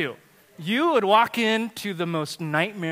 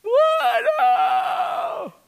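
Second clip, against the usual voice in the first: put-on shocked child shout; 6 dB louder than usual. Child protesting, imitated.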